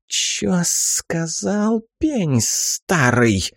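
put-on slow, menacing old hag; offended old woman, drawn out; old crone voice.

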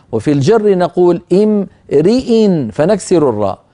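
ordinary delivery

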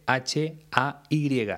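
each letter of "H A Y" named slowly and apart; careful slow teacher spelling. Shown as a demonstration. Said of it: "La forma verbal hay".

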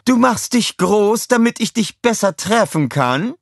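high, sneering villain voice; mock him while circling; taunting villain. A narrator's reading. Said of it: "rief sie".

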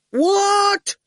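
high loud roar. Acted. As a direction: bellow it in outrage. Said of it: "roared Quentin".

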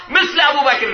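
high, ringing climax; impassioned proclamation. Acted. Preacher capping his praise of Abu Bakr, impassioned. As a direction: proclaim it at full preaching pitch.

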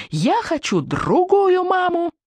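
put-on very high slow child's wail; child's scream. A narrator's reading. Furious son screams at his mother during tantrum, high and slow. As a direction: scream it like a sobbing toddler. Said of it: "закричал Зигги".

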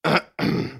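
put-on old soldier's cough before speaking; short, dry harrumph; throat clearing. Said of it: "Старый солдат кашлянул".

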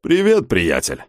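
slow, drawn-out greeting; lightly voiced laid-back ape, taking his time; easygoing old hand. A narrator's reading. Mister Smith greets the new monkey in the cage, easygoing.

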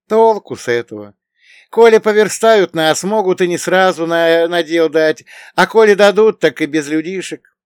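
high, loud, troubled character voice; worried young companion. Acted. Bogdan voices his worries.

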